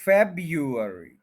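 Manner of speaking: February slowly as FEB-yu-er-ee; pronunciation with Y. Shown as a demonstration. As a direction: say a y after the b in February. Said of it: "इसका pronunciation ये बताता है". (February, वाई के साथ)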